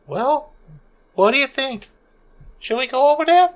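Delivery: very high, tiny falsetto; squeaky character voice. Acted. Small character asking companions whether to go over, squeaky falsetto. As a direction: pipe up in a high little voice.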